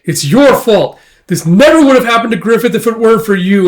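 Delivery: high, loud rush of blame; angry accusation. Acted. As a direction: act a distraught comrade lashing out. Casca blames Guts in tears.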